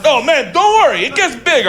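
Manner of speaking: loud, high-pitched mock protest; arguing back. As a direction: imitate a defensive guy arguing back. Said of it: "he starts arguing with me".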